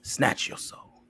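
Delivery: slow whispered menace. Acted. Host hushing a spooky line to Tina, slow whisper. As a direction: breathe it slow and sinister.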